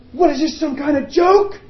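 high, loud outraged yell at God; furious cry, red in the face; screamed. Retold or imitated. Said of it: "he screamed".

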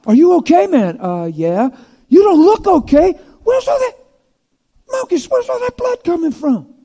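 high, loud, startled questioning; alarmed bystander. Acted. Onlooker startled by Malchus's bloody shirt, high and alarmed. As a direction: sound shocked at all the blood.

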